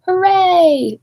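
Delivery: high, loud cheer; cheering hooray. Acted. Clowns celebrating Dumbo's jump with a high loud cheer. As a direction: cheer him at full voice. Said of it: "they shouted".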